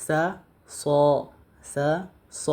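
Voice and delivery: সা said light and thin, স heavy; thin versus thick sa. Shown as a demonstration. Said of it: "জবর দিয়ে সিন পড়ি". (পাতলা সা আর মোটা স)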